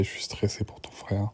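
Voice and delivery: whispered, barely voiced confession. Father admitting to his daughter he is stressed, whispered. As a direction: let it drop to a breathy whisper.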